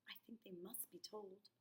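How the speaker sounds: hushed urgent whisper. Acted. Whispered urging.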